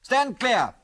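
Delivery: high, called-out command; shouted warning. A narrator's reading. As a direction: shout a warning to get back. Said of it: "shouted Biggles".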